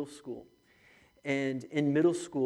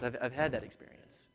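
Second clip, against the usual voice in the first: ordinary delivery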